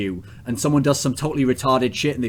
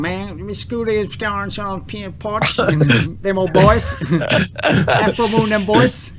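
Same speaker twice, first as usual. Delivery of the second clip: mock Southern drawl; 6 semitones higher, slower than usual. Host mocks Saint's Southern accent, hammed-up Southern drawl. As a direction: tease a Southern teammate with an overdone country drawl. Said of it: "Saint has a very nice drawl".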